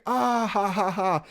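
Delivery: high, drawn-out fake "ah ha ha ha"; exaggerated imitation laugh; mock laughter. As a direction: imitate a group laughing in your face. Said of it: "they all busted out laughing".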